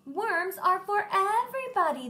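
crying out. Acted. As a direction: call it out in protest. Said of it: "they cried".

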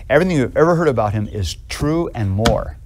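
ordinary talk

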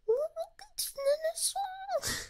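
drawn-out high sing-song voice; slow high imitation. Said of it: "She's showing, like, the whole staff".

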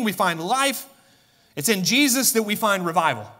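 high, emphatic proclamation; impassioned preaching. Real, not acted. Preaching builds to a climax.

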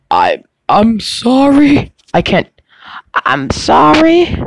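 high, slow, failed old woman imitation. Imitating the homeless old woman apologizing, high and slow. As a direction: stretch it out like a frail old lady, badly. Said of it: "I can't do an old woman voice".

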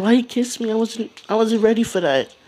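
put-on high, startled girlish voice; flustered girl. Nami kissed suddenly, flustered high voice. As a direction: play Nami caught off guard by a kiss.

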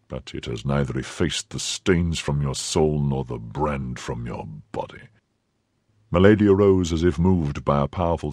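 low, soft, cold nobleman; Athos. Acted. Athos coldly confronts his former wife, low and soft.

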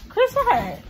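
loud high call across the room. Real, not acted. Mom calling her son who ran from the camera.

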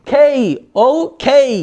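British "ও খেই" with aspirated k and gliding vowels; British-style okay. Shown as a demonstration. Teacher demonstrating British okay, British accent. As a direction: break it up in a British manner. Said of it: "এরকম বলে".

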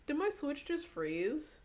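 high, slow, disbelieving; startled question. Real, not acted. Streamer asks chat if the Switch froze, high and slow. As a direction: stretch it out in disbelief.

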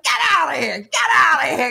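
shouting get out. Retold or imitated. Rider kicking at teen bikers, high shout. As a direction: kick and yell them away. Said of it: "I'm like".

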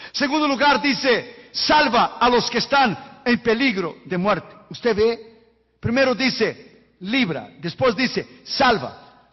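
high, loud, slow sermon cry; fiery preaching. Acted. Preacher driving the point home to the congregation, fiery. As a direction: preach it out full force.

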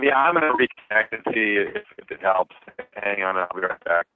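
normal talking voice

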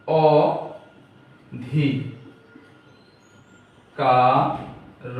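অ ধি কা র split into slow separate syllables. Shown as a demonstration. Teacher breaking অধিকার into syllables for students. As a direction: spell out অধিকার one syllable at a time with long pauses. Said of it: "সেই আকারটা আমরা এখানে".

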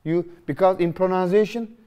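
ordinary delivery

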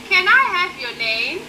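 ordinary delivery

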